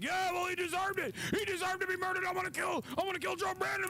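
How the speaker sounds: very high, shrill mock rant that ends in a shout; mock Republican rant. Streamer mocking a Republican fan, very high, building to a shout. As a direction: ham up a frothing Trump fan. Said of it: "He'd be like".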